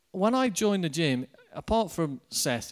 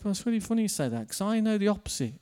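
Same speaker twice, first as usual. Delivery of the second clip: quick reply; 5 semitones higher, much faster than usual. Retold or imitated. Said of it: "And I said".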